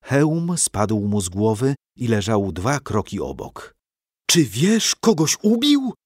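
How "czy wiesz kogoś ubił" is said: high, astonished question; exclaiming. A narrator's reading. Knight recognising the slain man, exclaiming high. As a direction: exclaim it in amazement. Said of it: "wykrzyknął Marcin".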